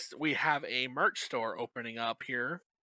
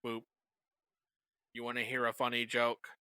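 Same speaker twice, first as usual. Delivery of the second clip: low, soft robotic monotone; 4 semitones lower, 8 dB quieter than usual. Pretend. Robot offering another robot a joke, robot voice. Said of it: "Robot voice".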